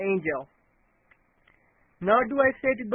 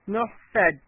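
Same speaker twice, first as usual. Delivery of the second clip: ordinary delivery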